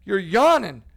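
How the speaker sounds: 3 hammed-up accusation, loud; 1 mock accusing shout. 4 big gym dude calling out the host's yawning, mock shout. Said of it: "and he's like".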